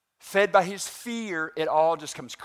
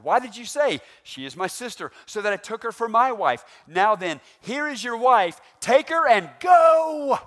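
furious Pharaoh; 7 semitones higher, 8 dB louder than usual. Acted. Pharaoh dismissing Abram and his wife, building to a shout. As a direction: drive Abram out in fury. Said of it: "Pharaoh's dismissal read".

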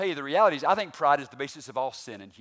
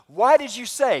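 angry Pharaoh; 8 semitones higher, 9 dB louder than usual. Acted. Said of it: "Pharaoh reading".

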